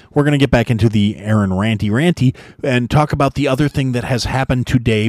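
normal talking voice

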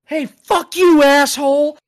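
high, booming mock promo yell; shouted insult. Manager turning on a man mid-promo, high mock shout. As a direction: bellow it as a wrestling manager's insult. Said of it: "looks this man dead in his face and goes".

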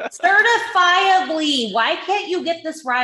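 high, loud, drawn-out correction; mock exasperation. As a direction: stretch it out slowly, then scold playfully. Mock scolding over a misheard word.

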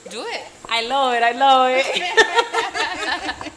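loud, laughing delight. Real, not acted. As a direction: gush with giggly joy. Guest delighted by the greeting.

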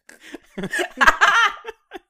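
high loud cackle; loud burst of laughter. Real, not acted. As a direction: break into a big laugh.

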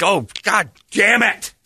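high, slow mock-exasperated cussing; retold exasperated curse. Realizing his buddy pranked him, retold high exasperation.

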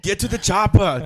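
high, loud bad Arnold; Arnold impression. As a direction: yell it like a botched action hero. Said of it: "that's a terrible one".

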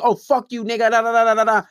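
high, loud mock cursing; talking crazy. Woman cussing a man out in public, mock angry tirade. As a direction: mimic a rant, trailing into nonsense syllables. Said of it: "she's talking to him crazy".

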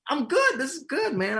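thrilled to see yourself on screen; delighted. Retold or imitated. Finally seeing the finished film.

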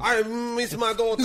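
high-pitched mock overacting; overacting main character. Co-host mimics the overacting gambler father, high melodramatic impression. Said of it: "That's my impression of him".

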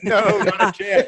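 laughing. Real, not acted. Refusing to edit out impression.

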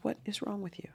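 high, very soft, near-whispered reproach; hushed rebuke. Retold or imitated. Stranger to her after cancer confession, hushed reproach. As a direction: drop to a hush in disbelief.

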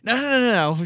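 rapid-fire reassuring denial; mock backtracking. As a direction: wave it away in pretend alarm. Acting out quick backtracking by writers.

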